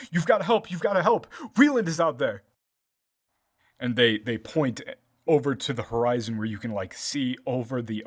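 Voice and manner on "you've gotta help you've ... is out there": loud, high-pitched cry for help; panicked shout. Acted. Villagers running up to the soldiers, shouting for help. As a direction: call out urgently, pitched well up. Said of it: "and they just shout".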